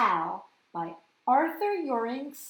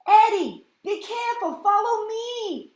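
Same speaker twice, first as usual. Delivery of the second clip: high loud squawking call; high urgent squawk; 9 semitones higher, 9 dB louder than usual. Acted. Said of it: "Al squawked".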